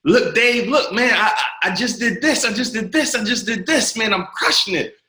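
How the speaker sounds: high, eager boasting to his mentor; excited young kid. Retold or imitated. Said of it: "like, excited".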